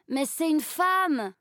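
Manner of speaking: aigu et lent, buté; répète, têtu et outré; garçon qui insiste. A narrator's reading. (garçon qui insiste, voix aiguë et lente)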